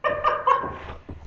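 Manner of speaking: quick airy giggle; a little embarrassed laugh at the onion tears. Real, not acted. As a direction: laugh it off lightly.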